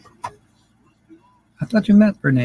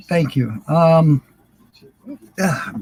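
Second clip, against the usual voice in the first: ordinary delivery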